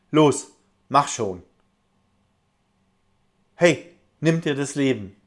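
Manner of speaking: loud, harsh commanding bellow; shouted commands. Retold or imitated. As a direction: bark the orders like a tormentor. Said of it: "brüllte". (brüllend und befehlend)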